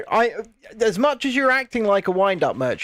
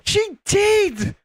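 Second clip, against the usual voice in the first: high-pitched protesting insistence; emphatic insistence; 12 semitones higher than usual. Real, not acted. Storyteller contradicting a doubting friend, high emphatic insistence. Insisting against repeated denial.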